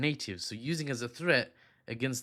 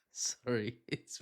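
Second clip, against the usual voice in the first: laughing; 7 dB quieter, much slower than usual. Real, not acted. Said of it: "I've just lost it completely".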